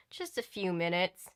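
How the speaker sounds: plain reading voice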